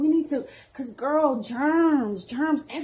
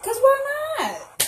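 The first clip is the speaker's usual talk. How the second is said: much higher, sassy comeback; defiant retort; 13 semitones higher, 5 dB louder than usual. Acted. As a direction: toss it back with sass. Defending her ugly wig.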